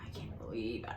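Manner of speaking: soft, breathless mock shock; hushed retold outrage; whispered disbelief. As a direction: whisper it to yourself. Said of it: "I would have been like".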